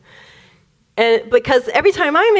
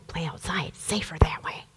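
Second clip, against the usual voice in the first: soft, whispered aside; hushed whisper; 6 semitones lower, 12 dB quieter than usual. Real, not acted. Sisters keeping out of the tense kitchen, whispered. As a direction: let it drop to a whisper, like sharing a secret.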